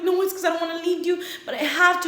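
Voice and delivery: high, hurried reenacted plea; dramatic pleading. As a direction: plead with him, hammed-up and urgent. Acting out a pleading breakup.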